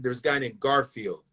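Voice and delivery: ordinary talk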